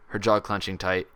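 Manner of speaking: ordinary delivery, nothing marked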